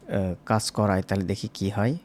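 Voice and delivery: ordinary talk, nothing marked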